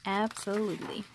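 ordinary delivery

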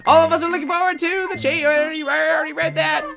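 high chirpy assistant voice; Isabelle voice. Acted. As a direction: squeak it out like a cute helper. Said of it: "why I'm giving Isabel that voice".

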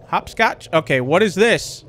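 normal talking voice

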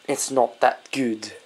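put-on low, soft, wobbly Scottish accent; a bad British accent slipping into Scottish; Scottish-sounding accent. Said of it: "That sounded Scottish".

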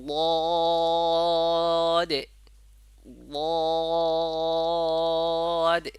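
long held vowel in "বদে", released into d at the end; drawn-out letter demo. Shown as a demonstration. Teacher shows how long to hold it, drawn-out demo. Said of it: "যেমন আমি বলতেছি".

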